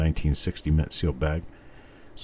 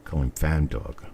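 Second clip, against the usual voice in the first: Massachusetts accent; 2 semitones lower, 3 dB quieter than usual. Shown as a demonstration. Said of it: "like, a Massachusetts accent".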